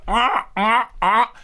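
high squeaky strangled ahs; squeaky rubber duck. Retold or imitated. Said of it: "sounded like you doing a duck impression".

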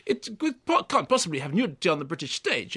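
usual speaking voice

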